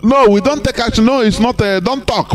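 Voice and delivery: high, sneering mock of a dismissive official; mocking imitation.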